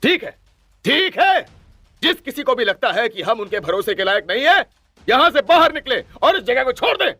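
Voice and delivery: high-pitched furious ultimatum; heated, very high outburst; even angrier. A narrator's reading. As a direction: throw down a challenge in anger. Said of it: "और गुस्से से कहा".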